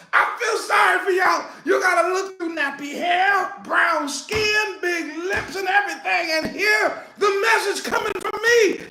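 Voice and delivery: high, exclaiming sermon delivery; preach. Real, not acted. Preacher telling doubters to hear the message, high forceful preaching.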